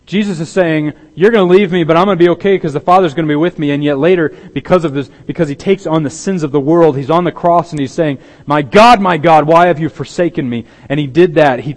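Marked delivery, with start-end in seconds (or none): 1.2-3.7 louder, fast confident declaration; emphatic Jesus. Pretend. Jesus telling the disciples they will leave, loud and sure. 8.4-10.7 higher, louder anguished cry. Pretend. Jesus on the cross crying to God, loud and anguished.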